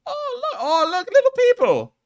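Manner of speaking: high, loud mock Irish with a laugh; hammed-up Irish delight at the little people; Irish accent. Spotting people on the map, loud mock Irish. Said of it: "speaking like I'm Irish".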